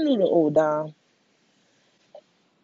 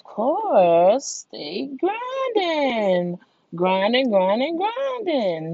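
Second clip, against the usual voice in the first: slow, stretched sing-song grinding; drawn out; 6 semitones higher, much slower than usual. Real, not acted. Urging listeners to keep working, slow stretched chant. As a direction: stretch the words out slowly, higher.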